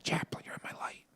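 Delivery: soft whisper to the dog. Real, not acted.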